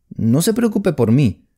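ordinary delivery

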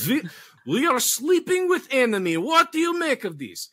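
put-on hamming a Russian confession in a Russian accent; mock Russian accent. Host keeps the mock Russian accent for the sleeping-with-enemy joke. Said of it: "let's get into Russian".

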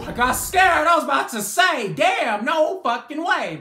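hyped-up relieved gamer; excited relief. Real, not acted. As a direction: blurt it out after a close call. Relief after close win.

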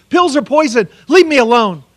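a mild mock yell of a frantic son; retold scream. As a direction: half-shout it as the angry son refusing pills. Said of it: "he screamed at me".

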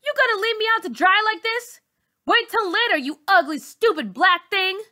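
very high, loud, spitting insults; furious insults. Acted. Bully insulting the girl who turned on her, furious high shout. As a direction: tear her down with every word. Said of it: "Char says".

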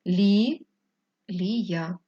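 "Лі-лія" with a pause after "Лі"; hyphenation demo. Shown as a demonstration. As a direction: break "Лі-лія" after the first syllable. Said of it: "переносимо так".

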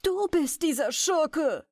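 high, slow outcry; shouting. Acted. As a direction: hurl the accusation out high. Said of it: "schrie der Hirt".

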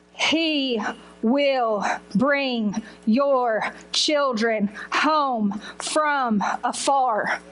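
deliberate, spaced-out verse reading; solemn scripture reading. Retold or imitated. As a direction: read each word as a weighty promise. Reads the Bible verse aloud.

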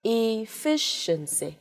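"Efficiency" very slowly, syllable by syllable; slow-motion model of "Efficiency" with a long "sh"; sounded out clearly. Shown as a demonstration. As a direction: stretch "Efficiency" into "i-FISH-shen-see". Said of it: "Chúng ta thấy rất rõ này".

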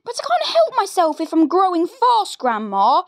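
a polite little boy, voiced lightly; young George. George protests.

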